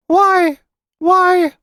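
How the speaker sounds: put-on very high, loud child voice; nervous little boy. A narrator's reading. Boy asked what the house whispers, high and nervous. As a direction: falter like a nervous small boy. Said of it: "said the boy nervously".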